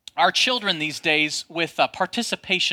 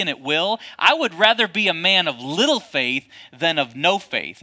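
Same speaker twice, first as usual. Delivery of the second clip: ordinary delivery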